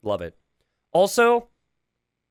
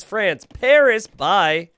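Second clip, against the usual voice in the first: higher, louder and slow, savouring each word; gleeful chat readout; 6 semitones higher, 7 dB louder, much slower than usual. Retold or imitated. Commentator reading the player's chat with glee. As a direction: read it out like a prize line. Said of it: "He says".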